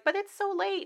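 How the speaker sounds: high, fretful friend voice; a lightly voiced worried friend.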